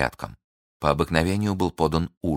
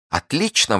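high, loud defiant Отлично; defiant high cry; exclaiming; 10 semitones higher, 3 dB louder than usual. Acted. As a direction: fling it back at him. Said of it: "воскликнула я".

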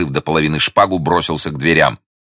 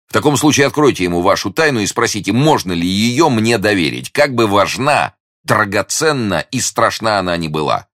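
a hint of an urgent hero, pitched higher; lightly voiced eager young gallant; 6 semitones higher than usual. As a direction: plead earnestly in a higher voice. Young hero urging her to confide.

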